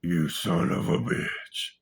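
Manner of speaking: slow, rasped-out curse; slow, voiceless-edged seething accusation; acted accusation. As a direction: draw it out in stunned outrage. Acted accusation of sleeping friend.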